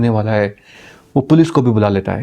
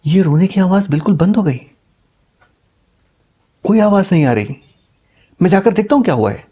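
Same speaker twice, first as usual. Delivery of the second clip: anxious urgency; 6 semitones higher, faster than usual. Acted. Son reports crying stopped.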